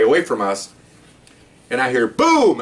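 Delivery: usual speaking voice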